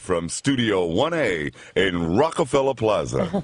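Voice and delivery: slow, booming studio announcer. Shown as a demonstration. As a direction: stretch it out like a live TV open. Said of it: "get our day started in the voice booth".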